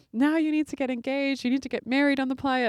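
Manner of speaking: lightly imitated enthusiastic push; excited urging. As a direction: hype them up like an eager party friend. Stranger urges couple to marry.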